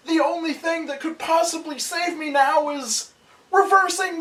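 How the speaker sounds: high, loud panicked shrieks; panicked kid screaming. Acted. Voicing Junie falling to his death.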